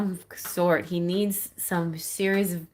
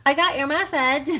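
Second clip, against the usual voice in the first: higher, louder teasing call; playful surprise; 8 semitones higher, 7 dB louder, faster than usual. Pretend. Surprising him in his bedroom, gleeful call. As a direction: announce it gleefully. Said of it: "then say".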